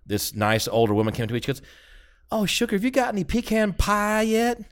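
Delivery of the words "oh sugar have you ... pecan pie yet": a lightly put-on Southern lady asking a guest about pie; old lady Southern accent. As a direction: coo it like a Southern grandmother offering pie. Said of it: "doing the old lady Southern".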